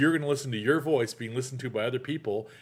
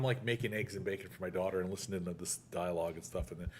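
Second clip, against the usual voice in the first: ordinary talk, nothing marked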